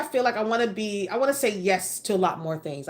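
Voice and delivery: ordinary talk, nothing marked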